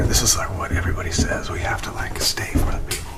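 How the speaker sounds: hushed whisper. Real, not acted. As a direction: whisper so nothing hears. Whispering on camera in cellar.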